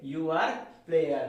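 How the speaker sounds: slow, careful dictation pace. Shown as a demonstration. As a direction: spell it out word by word for the class. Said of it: "model sentence dictated slowly".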